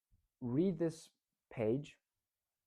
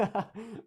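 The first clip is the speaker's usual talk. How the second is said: laugh; 8 semitones higher than usual. Real, not acted. Laughs at own silly voice.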